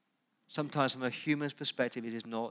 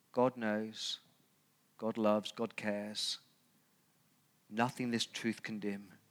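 poem recitation. Shown as a demonstration. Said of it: "It's a poem by".

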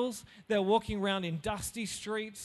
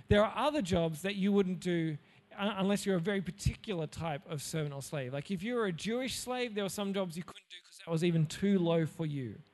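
usual speaking voice

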